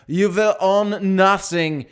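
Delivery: slow, loud mock German accent with a v for will; a hammed-up German Bond villain accent, menacing and slow; mock German villain growling out every word. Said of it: "sounds like a Bond villain".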